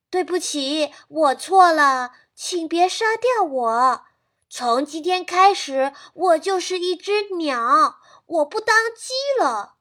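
a scared rooster begging for its life; urgent pleading. Acted. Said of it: "急切的啼叫声". (急切地求饶)

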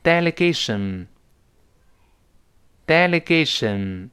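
slow, drawn-out "delegation"; measured dictation-style "delegation"; read-along model. Shown as a demonstration. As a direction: say "delegation" slowly for students to repeat. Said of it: "跟老师读起来".

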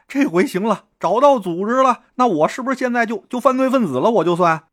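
high, eager rush; excited relief. A narrator's reading. Newcomer thinks he is now one of the gang, excited. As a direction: gush hopefully, pitched well up.

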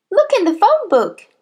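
put-on high little boy's voice; helpful schoolboy. A narrator's reading. Boy tells headmistress where to check, high-voiced. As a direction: pipe it up high and innocent.

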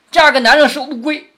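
high, loud comic payoff; blurted punchline. Acted. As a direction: burst out with the line at a high pitch. 薛蟠终于说出粗俗酒令. (高声抖出包袱)

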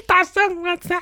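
high, cringing laugh into "大圣"; fawning laugh. Retold or imitated. Li Tianwang grovelling to the Great Sage, with a high fawning laugh. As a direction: laugh up high like a sycophant caught out.

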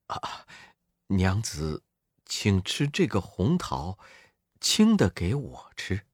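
soft, low and polite; gentle monk. A narrator's reading. The monk politely offers his wife the red peach, soft and low. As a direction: offer it quietly and courteously.

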